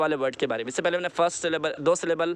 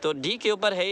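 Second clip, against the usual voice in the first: normal talking voice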